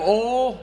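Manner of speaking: "Ole" for "oil", high and drawn; Southern "Ole". Retold or imitated. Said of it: "And I just go".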